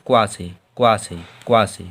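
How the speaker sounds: क्वा said low as one "kwaa" syllable; model sound. Shown as a demonstration. Teacher answers how to pronounce क्वा. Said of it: "इसका उच्चारण कैसे करना है?".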